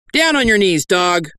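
high, sharp order; commanding. Acted. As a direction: command him like a stern preacher. Fake priest commands a bandit.

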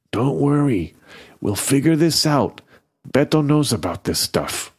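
plain reading voice

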